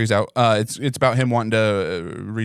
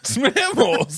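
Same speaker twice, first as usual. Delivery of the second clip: squeaky echo, pitched way up; high squeaky question; 14 semitones higher than usual. Acted. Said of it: "the voice changey stuff".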